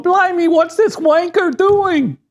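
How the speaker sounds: high, drawn-out cartoon British exclamation; exaggerated English fan, slow and indignant in a British accent; mock English accent. Host imitating English fans watching the match, mock British accent. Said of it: "English fans watching this going".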